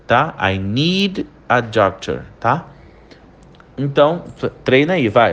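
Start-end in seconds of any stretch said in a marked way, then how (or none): 0.4-2.2 slower "I need a doctor", "need" held with a clear D; pausado. Shown as a demonstration. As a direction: keep "need" and "a" apart. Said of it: "quando a gente fala essa frase pausada". (pausado, com o D de "need" bem claro)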